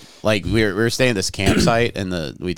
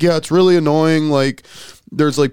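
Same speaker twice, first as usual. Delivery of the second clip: park ranger voice; 10 semitones higher, 5 dB louder than usual. Retold or imitated. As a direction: play the weary ranger, pitched up. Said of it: "And the park ranger's like".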